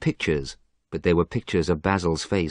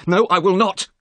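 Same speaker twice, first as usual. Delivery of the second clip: shouted refusal; 13 semitones higher, 7 dB louder than usual. A narrator's reading. Alan flatly refuses.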